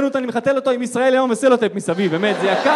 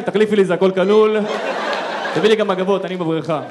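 mock demanding guest; 3 semitones lower, 4 dB louder than usual. Son as hotel guest.

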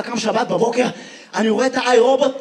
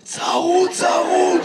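higher, mock hoarse reply; 5 semitones higher, much slower than usual. Audience man explains his voice, mock hoarse. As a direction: imitate a man straining through hoarseness. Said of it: "ממה אתה צרוד".

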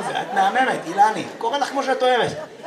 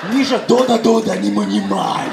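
Russian mother; 5 dB louder than usual. Retold or imitated. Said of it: "אמא רוסיה".

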